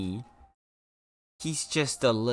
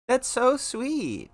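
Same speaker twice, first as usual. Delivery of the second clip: cooing; 11 semitones higher, 3 dB louder, slower than usual. Real, not acted. Puppy gift.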